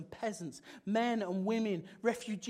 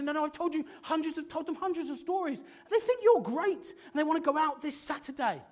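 higher, quick excited reassurance; eager insistence; 8 semitones higher, 5 dB louder than usual. Pretend. Mate insisting the date is on, higher and fast. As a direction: talk them round with enthusiasm.

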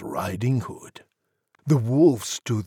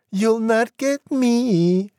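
high, slow, loud pig taunt; defiant squeaky piglet; crying-out piggy; 12 semitones higher, 6 dB louder than usual. A narrator's reading. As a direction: call it out high and drawn-out as a little pig. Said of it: "the piggy cried".

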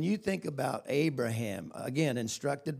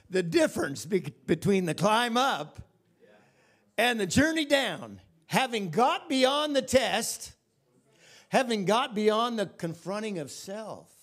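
raised, higher-pitched preaching in measured phrases; 6 semitones higher, 4 dB louder than usual. Acted. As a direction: build each phrase like a preacher. Preaches at full force.

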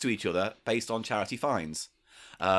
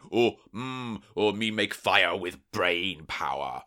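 caveman speak; 5 semitones lower than usual. Acted. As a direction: drag out the caveman words, halting and dim. Joins the caveman bit.

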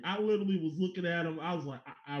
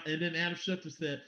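ordinary delivery

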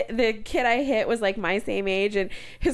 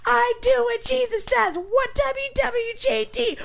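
mock raging driver; 12 semitones higher than usual. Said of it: "he's like".

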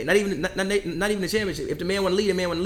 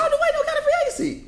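laughing disbelief. Real, not acted.

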